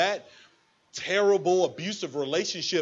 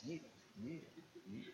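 ordinary talk, nothing marked

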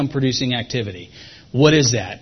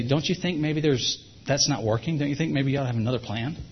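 very softly; 5 dB quieter than usual. Shown as a demonstration. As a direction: ease it in very softly. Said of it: "you say it very softly".

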